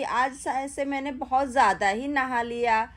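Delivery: ordinary talk, nothing marked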